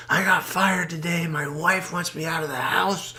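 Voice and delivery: high complaining voice; venting drunk. Retold or imitated. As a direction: whine out your troubles.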